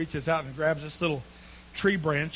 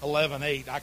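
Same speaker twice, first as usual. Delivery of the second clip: ordinary talk, nothing marked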